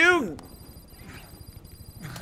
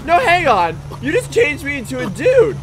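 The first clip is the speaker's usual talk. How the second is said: excited protest; 7 semitones higher than usual. Real, not acted. Confused protest at game events.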